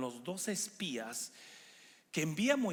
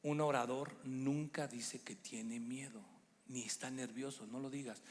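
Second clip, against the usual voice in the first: tender to wife; 4 semitones lower, 6 dB quieter than usual. Retold or imitated. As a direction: gently coax his wife. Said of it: "Le dije".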